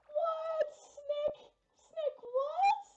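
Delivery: very high, loud shrieks of disbelief; overwhelmed yelp at a donation; squealing surprise. Real, not acted. Donor name read, overwhelmed.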